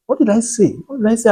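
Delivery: mildly startled self-questioning; puzzled. Retold or imitated. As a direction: wonder aloud, pitch lifted. Retelling own confusion at being laughed at.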